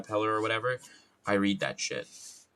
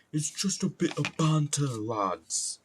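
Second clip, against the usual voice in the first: higher, punchy British lad voice; violent British accent; 6 semitones higher, slower than usual. Acted. Streamer bantering with imagined lads, violently British. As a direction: hit it hard in mock British. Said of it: "My British accent is very violent".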